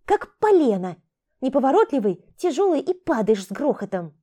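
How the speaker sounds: high, loud scolding. Acted. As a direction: tell him off. Thief scolds him.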